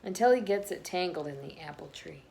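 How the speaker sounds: lively picture-book read-aloud voice; reading to children. A narrator's reading. Reading a picture book to listening children, bouncy and lively. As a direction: read the story to the little ones.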